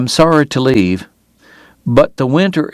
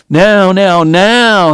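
rising urgent plea, louder and higher; prayed; 7 semitones higher, 4 dB louder, much slower than usual. A narrator's reading. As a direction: cry out the prayer, climbing. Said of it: "he prayed".

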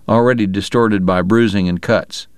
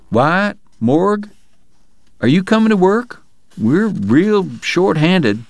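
lightly voiced deputy; 10 semitones higher, slower than usual. Chuck calling the brothers.

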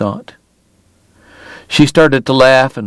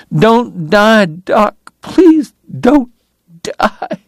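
high, slow desperate plea fading out; begging; 9 semitones higher, much slower than usual. A narrator's reading. Sobbing Kate begs Doc to live, pleading. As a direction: plead high, voice giving out on the last word. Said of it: "she begged".

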